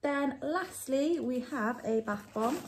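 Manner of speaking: ordinary talk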